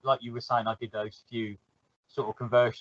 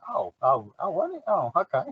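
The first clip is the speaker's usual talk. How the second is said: sheepish surprise; 5 semitones higher than usual. Retold or imitated. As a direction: voice jumps up on won't it. Mock reply to mentor's correction.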